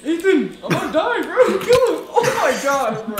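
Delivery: very high, very loud panicked yelling; excited screaming. Real, not acted. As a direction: scream for help while laughing. Panicked gaming fight, mob attack.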